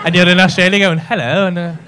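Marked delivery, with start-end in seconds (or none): 1.0-1.5 loud, hammed-up English-accented greeting; mock English accent. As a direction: imitate an English accent abroad, loudly. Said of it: "Why the fuck have you got an English accent?".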